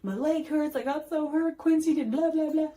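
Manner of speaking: put-on high, upset little boy voice; whiny hurt kid. Mom retelling her hurt son's cry, high whiny child voice. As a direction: act out the hurt son, pitched high. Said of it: "and he's like".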